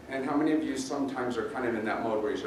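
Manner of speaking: ordinary delivery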